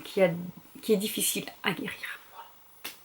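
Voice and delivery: hoarse, failing voice from a sore throat. Real, not acted. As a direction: voice giving out, barely there. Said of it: "J'ai une angine". (voix cassée par l'angine)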